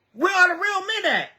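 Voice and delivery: high, fast demand; where are the real men, rising high; 1 exasperated cry. Real, not acted. Angry rant about absent men.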